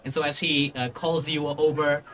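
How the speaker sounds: ordinary talk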